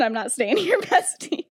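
mock defiance breaking into a laugh; laughing retort. Lily refuses her friend, acted out with giggles. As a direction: crack up on the last word.

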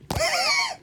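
high, soft dramatic gasp; sharp gasp. Acted. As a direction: gasp in mock shock. Dramatic reaction during story.

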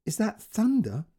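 holding fear in, trying to stay level; forced steady voice. A narrator's reading. Mother asks her dad about thunder, forcing her voice steady. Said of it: "fought to keep her voice steady".

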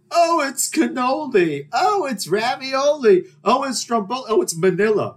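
high, slow singsong mockery; mocking clever nicknames. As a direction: parody their smug wordplay. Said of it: "they all think they're clever".

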